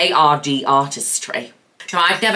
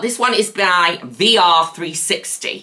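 ordinary delivery

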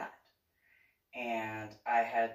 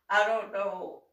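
ordinary talk

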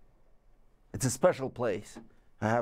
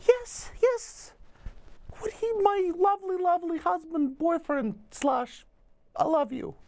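much higher, sweet put-on partner voice; honey's voice. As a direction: gush lovingly as the partner in falsetto. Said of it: "It's like".

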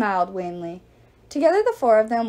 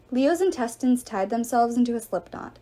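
ordinary delivery

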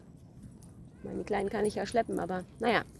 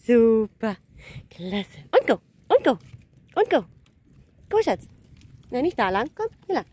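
cheering on dog; 6 dB louder than usual. Real, not acted. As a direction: urge the dog on. Encouraging dog in training.

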